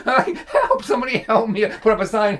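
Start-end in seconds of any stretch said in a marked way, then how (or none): 0.5-1.7 mock cry for help. Mock plea, overmatched by dad.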